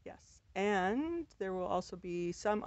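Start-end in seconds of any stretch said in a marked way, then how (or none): none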